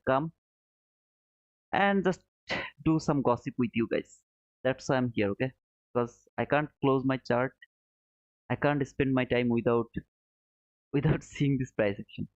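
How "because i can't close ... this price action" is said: normal talking voice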